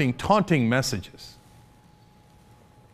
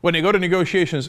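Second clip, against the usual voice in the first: ordinary talk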